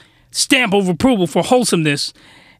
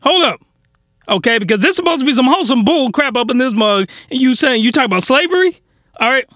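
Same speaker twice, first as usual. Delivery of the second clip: higher-pitched retold protest, hammed up; mock outrage; 6 semitones higher than usual. Reviewer retelling his own reaction to the anime, in mock outrage. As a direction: play up indignation at the scene. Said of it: "I was like".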